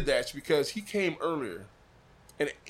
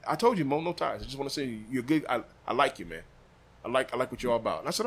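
ordinary talk

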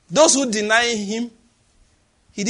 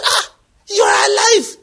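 surprised cry; 12 semitones higher, 5 dB louder than usual. Acted. Paul's surprised reply voiced.

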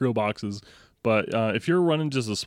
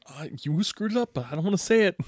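higher, softer, said under the breath; muttered inner thought; 7 semitones higher, 6 dB quieter than usual. Retold or imitated. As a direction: mutter it as if only to yourself. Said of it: "And you're like".

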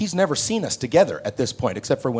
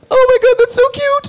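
much higher, louder, laughing squeal; gushing delight; 8 dB louder than usual. Retold or imitated. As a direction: melt at how cute he is. Actress charmed by the kid.

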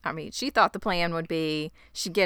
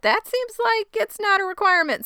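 mock high Eloise; 14 semitones higher, 3 dB louder than usual. Eloise questions the room.

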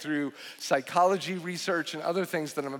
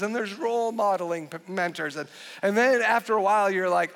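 ordinary talk

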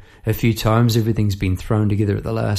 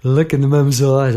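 usual speaking voice

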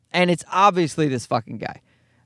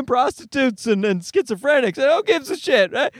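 forced laugh-along; 5 semitones higher than usual. Retold or imitated. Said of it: "trying to laugh along with them".

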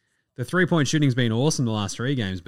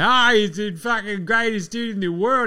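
high, mocking fanboy gush; high mock hype voice; 9 semitones higher than usual. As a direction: parody the breathless Tatum fans. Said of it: "resistant to the Tatum narrative of like".

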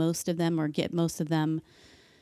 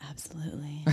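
sultry voice; 11 dB quieter than usual. Acted. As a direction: breathe it out seductively. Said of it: "that sultry voice".

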